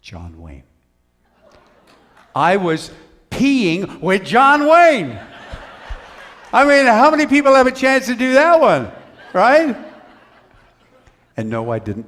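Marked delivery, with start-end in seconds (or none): 0.1-0.6 low, quiet deadpan name drop; hushed reveal. Retold or imitated. Hushed reveal of famous man beside him. 2.3-9.8 high, very loud punchline outburst; incredulous comic shout. Retold or imitated. Comic outburst at his own punchline.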